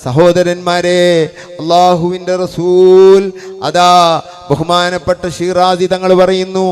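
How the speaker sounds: fiery preaching. Acted. Preacher citing a book to his brothers, loud fervent preaching. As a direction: call it out with preacher's force.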